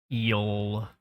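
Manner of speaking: ordinary delivery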